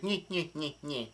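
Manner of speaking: soft imitated nyeh-nyeh cackle; weird laugh. Retelling how Brulé laughed at her victim, weird little mock cackle. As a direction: mimic an odd villain snicker, quietly. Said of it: "she starts laughing in a weird way".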